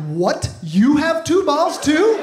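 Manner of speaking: put-on high, loud astonished child voice; shocked toddler. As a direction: imitate a little boy's disbelief, drawing it out. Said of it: "And he was shocked".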